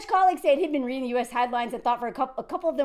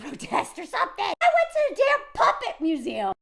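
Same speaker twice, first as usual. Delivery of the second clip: laughing, high-pitched exclamation; real laughing disbelief; amused disbelief; 6 semitones higher than usual. Real, not acted. Laughing disbelief at puppet museum exhibits.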